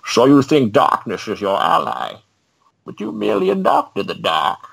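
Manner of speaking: high, muffled masked-villain voice; theatrical villain impression, pitched high and stretched out at the end; Bane. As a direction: monologue grandly like a masked supervillain. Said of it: "I had the best Bane accent".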